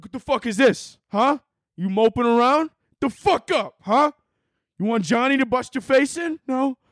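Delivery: high, threatening wiseguy voice; Italian mobster. Acted. Mobsters confronting sadness, cartoon intimidation. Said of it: "big-ass Italian mobsters".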